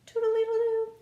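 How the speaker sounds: high, piping toodle-doo like a flute; playful high flute sound. Shown as a demonstration. Teacher showing kids how a flute sounds, piping high. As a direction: sing it out like a little flute. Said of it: "or the flute".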